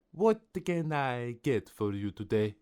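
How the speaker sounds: put-on French-accented shopkeeper voice; French baker. A narrator's reading. Baker serving a hungry customer, French-accented politeness. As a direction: offer help as a French baker would.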